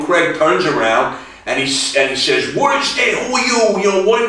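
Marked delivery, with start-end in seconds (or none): 2.5-4.2 high, urgent demand; agitated confrontation. Retold or imitated. Daniel Craig confronting the stranger over a photo, agitated demand.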